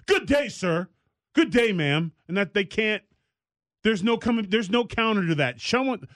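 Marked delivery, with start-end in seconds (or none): 0.1-2.1 higher, lofty dismissive farewell; haughty good day. Shown as a demonstration. Ending an unwanted political chat, lofty snub. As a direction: shut them down with a prim good day. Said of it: "I'm just gonna say".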